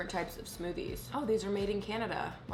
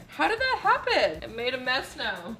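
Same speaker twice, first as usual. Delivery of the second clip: dismayed; 5 semitones higher, 4 dB louder than usual. Real, not acted. Dismay over spill.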